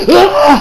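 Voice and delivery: high mock gasp-scream; startled scream. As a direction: wake up with a hammed-up yelp. Character waking in shock.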